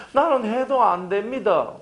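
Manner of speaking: slow, whiny mock complaint. Pastor mimicking a discouraged believer, slow and whiny. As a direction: drag it out like a defeated believer. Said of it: "하는 분들한테 꼭 이 얘기해주세요".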